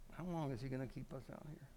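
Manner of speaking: hushed complaint under the breath; quiet grumbling. Acted. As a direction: grumble it softly, as if to the person beside you. Voices guests bundled up, grumbling.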